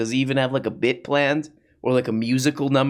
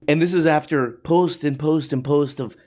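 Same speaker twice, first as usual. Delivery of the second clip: ordinary talk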